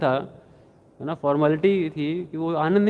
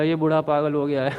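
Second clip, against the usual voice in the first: ordinary delivery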